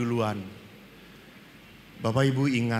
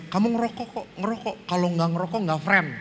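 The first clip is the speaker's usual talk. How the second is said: pushy school friends; 3 semitones higher, faster than usual. Retold or imitated. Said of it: "saya diminta".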